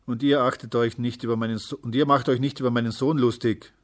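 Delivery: loud, biting rebuke; angry father warning them off loudly; venomous call. A narrator's reading. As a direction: snap at the crowd in defence. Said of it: "rief er giftig".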